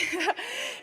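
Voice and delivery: quick high-pitched giggle; a short nervous laugh. Real, not acted. Athlete giggles in interview.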